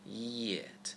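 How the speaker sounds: yet stretched out from rounded lips. Shown as a demonstration. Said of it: "shape our mouths to U before we read".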